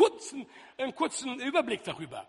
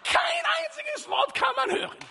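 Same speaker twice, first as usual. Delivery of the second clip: much higher, loud punchline; punchline shout; 3 dB louder than usual. Acted. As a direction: belt the punchline out. Punchline repeated.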